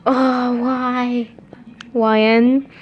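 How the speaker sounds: ordinary delivery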